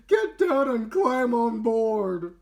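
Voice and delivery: high, loud, slow command; urgent rescuer. Acted. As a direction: call her sister aboard. Olivia rescuing her sister.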